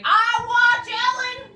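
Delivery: loud over-clear reply like to a foreigner; mimicking the miner shouting back; miner's loud answer. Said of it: "He was like".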